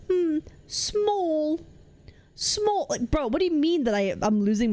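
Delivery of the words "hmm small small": higher-pitched, teasing imitation of a British accent; mock a British accent on "small", pitched up. Streamer imitates Techie teasing their word, mocking British accent. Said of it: "Techie made fun of my accent".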